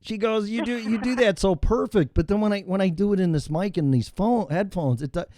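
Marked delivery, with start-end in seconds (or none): 0.5-2.1 mimicking his friend. Friend praises his Arnold voice.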